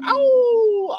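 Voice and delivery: long high wolf howl. Shown as a demonstration. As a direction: throw your head back and howl. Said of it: "I will howl for him".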